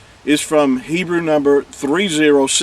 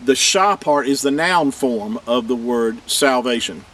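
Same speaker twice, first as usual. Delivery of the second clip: ordinary delivery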